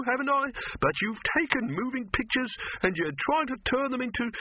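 high-pitched, agitated complaint; distressed protest. A narrator's reading. Silverfish quits Dibbler's moving pictures, upset.

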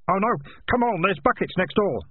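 high, urgent call to action; alarmed, high-pitched urging. Acted. Keith urges others to fight fire.

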